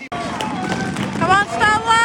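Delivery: high, loud football chant; cheering. Real, not acted. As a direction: roar the team on from the stands. Cheering team at match.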